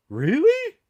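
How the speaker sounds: high, loud disbelieving really; incredulous. Real, not acted. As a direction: sound genuinely taken aback. Incredulous reaction to closing news.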